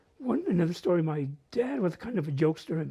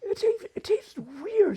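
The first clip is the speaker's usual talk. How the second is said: put-on high, slow child voice tasting something odd; lightly voicing a seven-year-old girl; little girl's complaint; 13 semitones higher, slower than usual.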